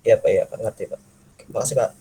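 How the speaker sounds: ordinary delivery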